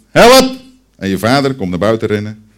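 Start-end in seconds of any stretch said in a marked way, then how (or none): 0.1-0.6 sudden high yell for help; panicked shout, much higher and louder; screaming for help. Acted. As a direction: cry out as if falling through ice. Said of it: "Gillend schreeuw je om hulp".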